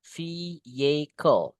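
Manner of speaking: VEHICLE slowly, "fee-YAY-kol", silent H; model pronunciation. Shown as a demonstration. Teacher showing how it is read, slow VEHICLE with silent H. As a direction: draw out VEHICLE without the H. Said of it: "tulis cara bacanya ya".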